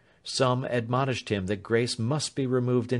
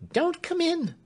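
much higher, fearful servant voice, stress on Don't; frightened warning. A narrator's reading. Clemency pushes Alfred back from the door, frightened. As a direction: warn him off in panic, leaning on Don't. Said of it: "she answered".

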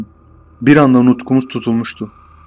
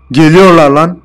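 loud, higher panicked yell; retold yell; 5 semitones higher, 7 dB louder than usual. Narrator yelling to friends while fleeing the field, loud panicked cry. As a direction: scream the warning as you flee. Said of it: "diye haykırdım".

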